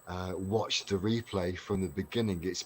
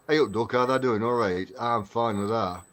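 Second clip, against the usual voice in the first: put-on louder, broad Derbyshire boyhood talk; broad Derbyshire dialect; 3 semitones higher, 6 dB louder than usual. Shown as a demonstration. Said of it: "an example of how I used to talk".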